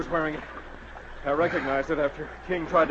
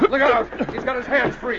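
shouting; 8 semitones higher, 4 dB louder, slower than usual. Acted. Warning shout in a fight.

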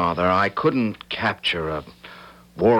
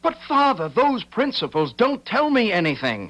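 much higher, strained protest; 14 semitones higher than usual. Acted. Son protesting the principles to his father, high strained. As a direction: push back desperately.

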